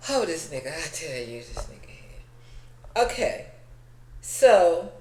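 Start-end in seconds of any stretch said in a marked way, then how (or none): 0.1-3.0 slow, breathless, hushed disbelief; exasperated aside. Real, not acted. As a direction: shake your head and hush it out slowly. Exasperated aside about Mecca.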